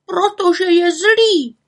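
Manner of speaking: high, slow cry of angry grannies. Acted. Grannies crying out that the forester is cruel. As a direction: shout it out in grief. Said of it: "křičely babičky".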